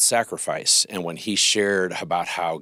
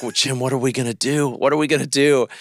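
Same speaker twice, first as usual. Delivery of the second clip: higher, tearful-sounding plea; distressed; 6 semitones higher, 4 dB louder than usual. Retold or imitated. Mom in tears on the phone to Jim, distressed. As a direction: mom in tears asking what now, pitched up. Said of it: "she's like".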